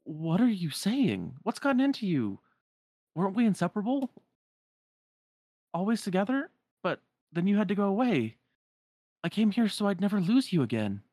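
high, insistent, hurt pleading; distraught young hero. Acted. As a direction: plead with the friend you came to save. Voices Sora pleading with Namine.

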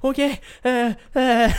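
high, loud flustered hesitation; panicked dithering; helpless stammer. Retold or imitated. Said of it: "Og jeg bare".

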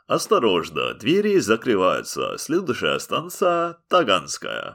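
Russian announcer voice, slow at end; Russian metro announcement. Pretend. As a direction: announce the next station in Russian. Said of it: "for our Russian listeners is".

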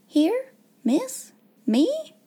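three high, rising questions; high-pitched amazed little maid; breathless astonishment. A narrator's reading. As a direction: question each word in disbelief. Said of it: "Becky lost her breath again".

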